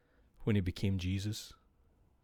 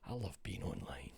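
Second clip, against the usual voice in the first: whispered aside to himself; unvoiced sarcastic whisper; 6 semitones lower, 14 dB quieter than usual. Real, not acted. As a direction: breathe it out, no voice. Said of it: "whispered aside".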